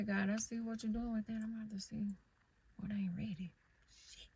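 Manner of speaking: dropping to a whisper; whispered aside. Real, not acted. Talking to herself about what isn't ready, whispered. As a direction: breathe it out under her breath.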